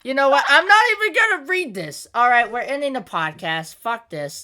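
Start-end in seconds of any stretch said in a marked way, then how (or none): 0.0-2.1 raised, irritated voice; high and exasperated; annoyed. Real, not acted. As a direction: refuse in a huff. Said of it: "actually really annoyed right now".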